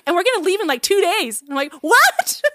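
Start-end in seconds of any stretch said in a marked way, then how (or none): 0.1-1.4 very high, imitated eager friend; retold giddy announcement; excited announcement. Imitating friend piling on news. 1.8-2.4 very high, loud retold disbelief; incredulous squeak of a question; shocked. Acting own shock at the news.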